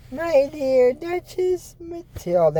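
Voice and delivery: high, slow put-on voice; odd knight voice. The silent knight greets the duchess in a high, slow voice.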